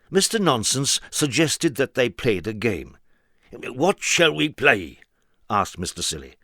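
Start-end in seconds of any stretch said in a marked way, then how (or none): 3.5-5.0 slow, higher voice; slow question. Acted. Mister Silly asking, slow and curious. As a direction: ask it slowly and curiously.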